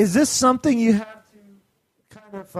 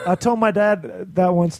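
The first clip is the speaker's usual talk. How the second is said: usual speaking voice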